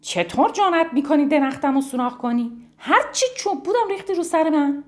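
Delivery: loud, high furious mouse; angry mouse shouting up at the woodpecker. Acted. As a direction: yell at the woodpecker in outrage. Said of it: "فریاد زد".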